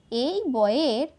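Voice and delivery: ordinary talk, nothing marked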